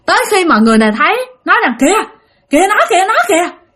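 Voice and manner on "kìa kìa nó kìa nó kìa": high, quick calls of discovery; pointing out urgently. Acted. Villager spots the missing boy, high urgent call-out. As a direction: call the others' eyes to the child.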